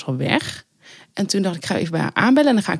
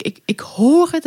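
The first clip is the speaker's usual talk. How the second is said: high, slowed, spooked; creeping fear, like in a horror film; scared whisper-thin voice; 9 semitones higher, slower than usual. Retold or imitated. Recalling hunting the phone, spooked and high. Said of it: "best wel een beetje horrorachtig".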